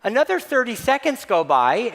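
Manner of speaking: usual speaking voice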